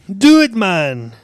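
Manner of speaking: loud, drawn-out Scottish command; angry Scottish accent. Acted. Boss cutting off the groundskeeper, slow angry Scottish order. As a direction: order it slowly as an irate Scotsman. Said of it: "And he's, and he's angry".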